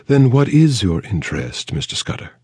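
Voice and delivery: a wary society lady probing; Mayfair accent. A narrator's reading. Actress probing the detective's motive, cool Mayfair accent. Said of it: "the Mayfair accent".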